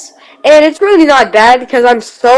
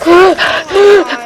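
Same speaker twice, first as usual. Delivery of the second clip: retold gasp; 7 semitones higher than usual. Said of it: "and he's like".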